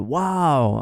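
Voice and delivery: drawn-out mock-awed "wow"; cheering admiration. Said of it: "they started, like, cheering".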